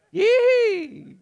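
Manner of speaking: high-pitched, drawn-out fake "yay"; mock cheer. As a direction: squeal with put-on delight at bad news. Mock joy at trouble coming.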